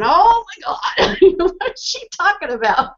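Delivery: high, laughing mock bewilderment; bewildered coworkers. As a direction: play the baffled listener, laughing. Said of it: "Carol and Ellie are going".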